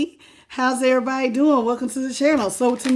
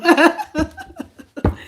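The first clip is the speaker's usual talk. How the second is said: a quick bright cackle; high laugh; 6 semitones higher, 4 dB louder than usual. Real, not acted. Joking about avoiding her sick kids, high laugh. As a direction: laugh at your own confession.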